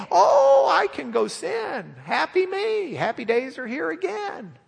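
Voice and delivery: high, sing-song glee; hammed-up happy sinner; mock carefree sinner. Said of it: "Don't think".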